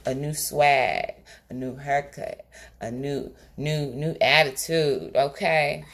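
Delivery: normal talking voice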